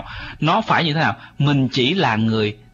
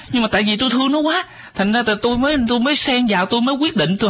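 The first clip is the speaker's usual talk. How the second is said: high-pitched mock pleading of a well-meaning elder; protesting parent; 8 semitones higher, faster than usual. Host voices grandparents defending their meddling, high and protesting. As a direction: imitate a self-justifying grandparent, pitched well up. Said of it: "nhiều ông bà đó nói rằng là".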